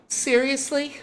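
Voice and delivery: very high-pitched, affronted question; disgusted disbelief. Acted. Girl shown mechanic's uniform, high and incredulous. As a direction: squeak it out in disbelief.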